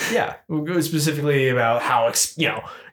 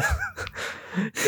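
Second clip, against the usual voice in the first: Daffy Duck laugh; 9 dB quieter than usual. Retold or imitated. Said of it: "Good Daffy Duck impression".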